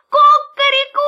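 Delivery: loud, high cock-a-doodle-doo; rooster crow. A narrator's reading. Rooster flaps his wings for the puppy, loud high crow. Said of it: "закричал".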